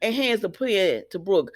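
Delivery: usual speaking voice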